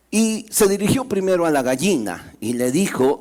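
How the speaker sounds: ordinary delivery, nothing marked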